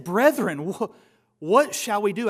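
loud, pleading cry of a stricken crowd; desperate cry. Retold or imitated. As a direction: cry out as if pierced to the heart. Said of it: "And they said".

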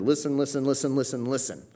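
Listen said over and over, each one stressed; emphatic repetition. Shown as a demonstration. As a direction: repeat Listen insistently in a quick, even string. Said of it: "You repeat the same word".

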